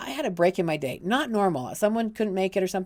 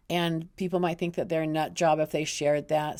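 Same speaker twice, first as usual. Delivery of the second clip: ordinary talk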